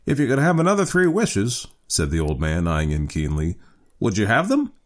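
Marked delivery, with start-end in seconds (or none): 0.1-1.7 put-on high-pitched old man's voice; old father questioning in a raised pitch. A narrator's reading. 4.0-4.7 put-on high-pitched old man's voice; old father questioning in a raised pitch. A narrator's reading.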